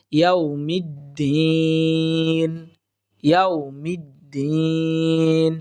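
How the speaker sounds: "ইয়াউমিদ্দিন" slow, clear "mid", long "deen"; correct tajweed. Shown as a demonstration. Teacher modelling the verse, careful tajweed. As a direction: hold the last syllable of "ইয়াউমিদ্দিন". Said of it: "সহিভাবে পড়ার চেষ্টা করব".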